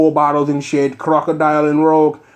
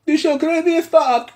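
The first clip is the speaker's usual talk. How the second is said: much higher, exclaiming; 12 semitones higher than usual. Shown as a demonstration. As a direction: squeal it high. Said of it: "Nope, not even gonna do it".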